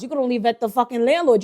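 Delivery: normal talking voice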